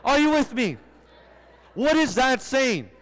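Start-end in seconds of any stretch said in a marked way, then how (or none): none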